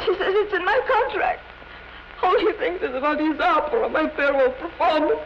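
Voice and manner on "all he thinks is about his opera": usual speaking voice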